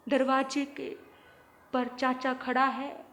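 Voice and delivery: ordinary talk, nothing marked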